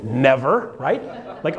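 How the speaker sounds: usual speaking voice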